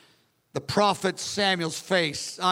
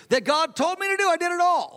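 excited boasting; 9 semitones higher than usual. Retold or imitated. Saul gushing to prophet Samuel about his victory, excited shout. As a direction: crow about the win to Samuel, well above normal pitch.

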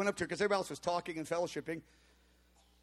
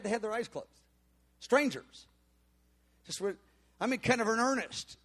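ordinary talk, nothing marked

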